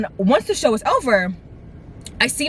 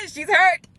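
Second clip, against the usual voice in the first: high, giggly voice; laughing; 9 semitones higher, slower than usual. Real, not acted. Storyteller joking about her late grandma, laughing as she talks. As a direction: talk through helpless laughter.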